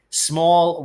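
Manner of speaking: ordinary delivery, nothing marked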